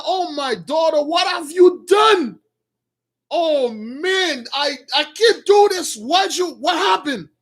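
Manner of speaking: high, pleading wail in a retold lament; distraught father. Father to the daughter who met him first, distraught. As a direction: cry it out high, stretching the key words. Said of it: "he nearly start crying".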